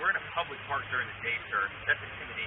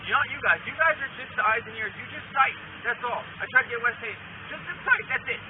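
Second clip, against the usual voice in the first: heated; 6 semitones higher than usual. Real, not acted. Heated argument with officer.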